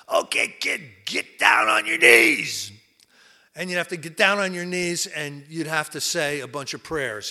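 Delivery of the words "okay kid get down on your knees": Doctor Bob barking the order loud and high; gruff order. Retold or imitated. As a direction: command the kid to kneel, shouting. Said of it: "and he'd go".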